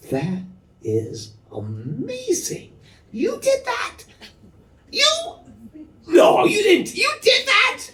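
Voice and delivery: very high, far-off amazed squeal; gushing proud dad. Retold or imitated. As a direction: overdo the delighted disbelief. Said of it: "A good dad says".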